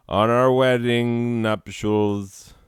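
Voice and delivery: high, slow, loud grand flourish; grand suitor. Acted. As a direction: draw it out like a swooning suitor. Mock proposal.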